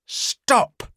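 sudden high sharp shout. Acted. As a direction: cut her off with a yell. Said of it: "shouted the stranger".

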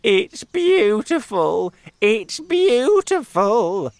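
high, loud, slow drawn-out praise; aunt cooing over the peach; gloating delight. Acted. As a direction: savour each word greedily. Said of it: "said Aunt Sponge".

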